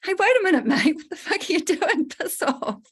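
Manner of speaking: hammed-up high indignant shout, laughing through it; mock outrage. Coach acting out a man kicking a stranger off his sofa, mock angry and laughing. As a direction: play the outraged homeowner for laughs. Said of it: "would you be like".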